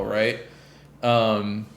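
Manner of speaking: normal talking voice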